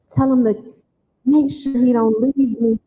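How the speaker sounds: normal talking voice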